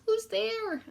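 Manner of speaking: high mouse cry. Acted. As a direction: call out as the startled little mouse. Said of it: "Mouse cries".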